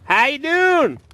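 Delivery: high, put-on Southern greeting; exaggerated Southern howdy; best Southern accent. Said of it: "in my best Southern accent".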